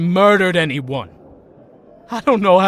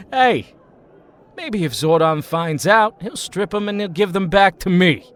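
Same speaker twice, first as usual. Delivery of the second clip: ordinary delivery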